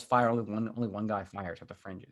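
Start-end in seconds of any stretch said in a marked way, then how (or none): none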